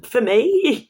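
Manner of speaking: high, loud disbelieving question; can't believe the gift is for you; stunned and touched; astonished. Retold or imitated.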